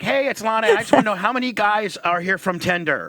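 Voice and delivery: put-on high, bright announcer-girl voice; imitating a bubbly girl. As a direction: chirp it like a perky host. Mimics Lana greeting her audience.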